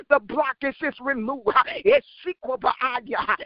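usual speaking voice

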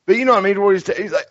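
ordinary talk

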